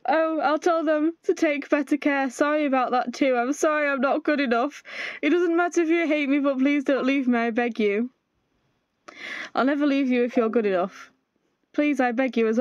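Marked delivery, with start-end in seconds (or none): none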